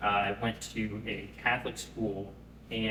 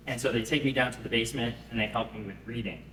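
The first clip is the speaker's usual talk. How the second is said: ordinary talk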